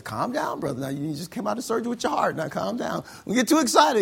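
loud, high mock scolding; agitated plea, pitched high; urgent cautioning. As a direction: try to rein him in, voice climbing. Acts himself settling excited patient.

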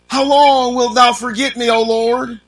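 high and loud, pleading each question; preaching at full force. Acted. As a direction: cry the psalm out to the congregation. Preacher reads psalm aloud.

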